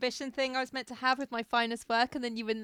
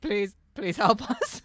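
hammed-up begging; 4 dB louder, much slower than usual. Begging exaggerated.

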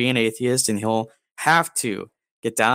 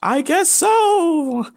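very high, stretched-out spooky voice; wailing ghost; 4 dB louder, slower than usual. Pretend. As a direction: whine it out as a cartoon ghost. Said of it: "Why do you talk like that, ghost?".

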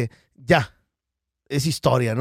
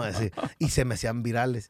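normal talking voice

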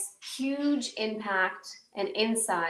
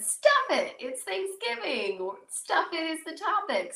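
mock retort; 8 semitones higher than usual. Retorting to the developers.

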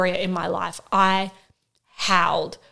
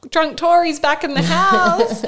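high, slow, loud mock announcement; husband's teasing call; 9 semitones higher, 5 dB louder, slower than usual. Husband ribbing his gas-drunk wife, playful loud call.

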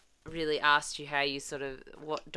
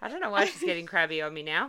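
normal talking voice

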